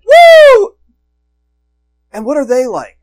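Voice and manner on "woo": high, loud joyful whoop; excited whoop. Retold or imitated. Joseph cheering about his dream, loud whoop. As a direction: let out a thrilled woo. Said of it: "he is like".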